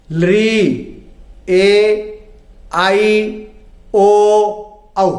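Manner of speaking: each vowel drawn out, short ones brief, long ones held; slow recited vowels. Shown as a demonstration. Teacher reciting the Hindi vowels for pupils, held and clear. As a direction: chant the vowel chart for pupils. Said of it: "तो हम बोलना सीखें".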